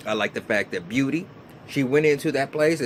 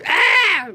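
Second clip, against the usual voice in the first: imitated shriek; 8 dB louder than usual. Maya's retold shriek at the bag.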